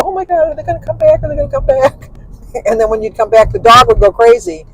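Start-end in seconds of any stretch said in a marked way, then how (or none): 0.0-2.1 anxious dog. Pretend.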